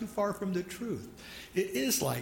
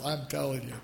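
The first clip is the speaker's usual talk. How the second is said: ordinary talk, nothing marked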